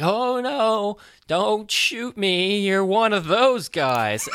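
high, loud, drawn-out bad American accent; terrible American accent. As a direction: wail it slowly in a hammed-up fake American accent. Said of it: "with his terrible American accent".

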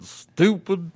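high, loud, slow dramatic mock voice; drawn-out mock-serious announcer. Mock secret word echoed.

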